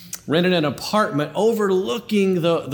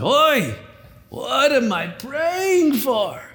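put-on Yiddish accent; 5 semitones higher than usual.

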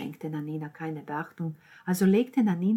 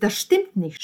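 loud, raised protest; shouted denial; 4 semitones higher, 10 dB louder than usual. A narrator's reading. Boyfriend and sister protest together, shouting. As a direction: cry out in instant denial. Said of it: "schrien der Freund und die Schwester".

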